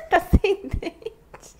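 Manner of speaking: loud laugh through the words; cracking up while speaking. Real, not acted. Laughing at the toothless pet.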